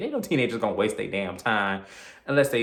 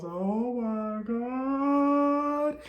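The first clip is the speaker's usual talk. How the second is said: terrified; much slower than usual. Retold or imitated. Wife sees the killer.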